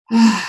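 loud, breathy exhale of release; audible relaxing sigh. Shown as a demonstration.

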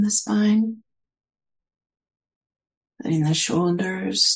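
unhurried meditation guidance with long pauses; guided meditation. Real, not acted. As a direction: gently guide listeners inward, leaving space between phrases.

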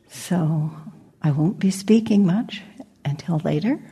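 ordinary delivery

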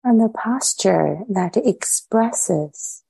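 unhurried meditation guidance with long pauses between phrases; measured phrases set apart by spacious silences; guided meditation. Real, not acted. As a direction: lead them inward, leaving silence after each short phrase.

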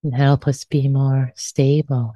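normal talking voice

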